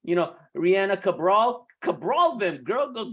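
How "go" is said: usual speaking voice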